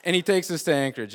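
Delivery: ordinary talk, nothing marked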